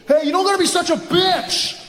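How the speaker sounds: high-pitched, indignant creep; hammed-up offended pickup guy; rejected creep. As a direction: turn nasty after being turned down. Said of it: "That creepy guy comes up".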